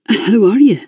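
a lightly strained repeat of the question. A narrator's reading. Raymond asking Eleanor again, lightly strained. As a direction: press the question again, tight. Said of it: "sounding strained".

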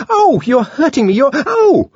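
high, loud yelp of pain; shrill-pitched, startled protest; cry of pain. Acted. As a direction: cry out as if being hurt. Grabbed and hurt by savage.

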